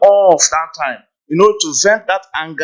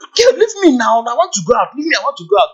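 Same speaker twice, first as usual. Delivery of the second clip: much higher, agitated plea to be let go; angry partner wanting out; 7 semitones higher, faster than usual. Retold or imitated. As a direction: push them off, you want out now. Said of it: "I'll be like".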